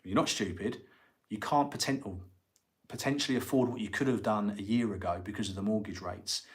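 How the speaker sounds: ordinary talk, nothing marked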